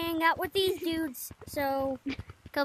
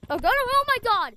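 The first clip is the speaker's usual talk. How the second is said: loud, high exclamation; raised, high-pitched shock; startled outcry; 5 semitones higher, 7 dB louder than usual. Real, not acted. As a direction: burst out in alarm. Startled outcry during the game.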